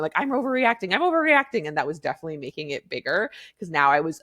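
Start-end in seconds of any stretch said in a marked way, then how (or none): 0.2-1.7 rising, louder frantic self-scolding; much higher, louder frantic repeat; panicked self-talk. Retold or imitated. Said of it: "in the moment".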